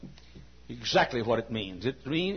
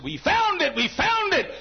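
much higher, ringing cry; triumphant cry; 5 dB louder than usual. Retold or imitated. Preacher to the Saturday crowd, high triumphant cry.

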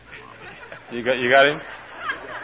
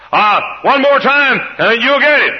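stern father; 11 semitones higher, 5 dB louder than usual. Acted. Preacher voicing a father drilling his child: firm, loud push. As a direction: push the child to try once more.

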